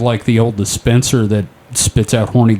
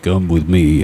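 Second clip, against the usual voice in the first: deep Sasquatch voice; 8 semitones lower than usual. Pretend. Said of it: "he's like".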